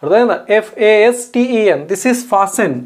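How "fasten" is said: FASTEN said as FAH-sen; silent T. Shown as a demonstration. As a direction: leave the T out of FASTEN. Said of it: "టీ పలకొద్దు".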